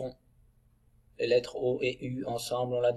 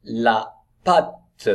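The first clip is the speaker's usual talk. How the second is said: loud, deliberate model reading; word-by-word reading; 7 dB louder than usual. Shown as a demonstration. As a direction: read it out carefully for young learners. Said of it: "Allez, on enchaîne".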